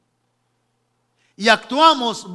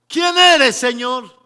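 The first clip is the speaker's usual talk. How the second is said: high, booming cry that falls away; loud cried question; 4 semitones higher, 9 dB louder, slower than usual. Acted. Paul asking who the Lord is, loud high cry. Repeats Paul's question to Jesus.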